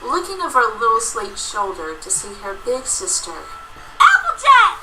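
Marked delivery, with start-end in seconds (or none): 4.0-4.8 high loud joyful cry; excited exclaim. Acted. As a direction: call out the name with delight. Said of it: "she exclaimed".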